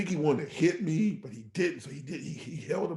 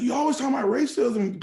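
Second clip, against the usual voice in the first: exasperated complaint; 9 semitones higher, 7 dB louder than usual. Retold or imitated. Wife complaining in retold argument.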